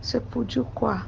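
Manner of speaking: normal talking voice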